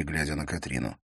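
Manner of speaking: ordinary delivery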